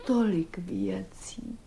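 slow, soft near-whisper; hushed musing. Acted. Woman dwelling on all that lies between them, hushed musing. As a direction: murmur it slowly, as if to yourself.

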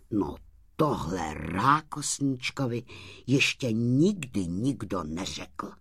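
plain reading voice

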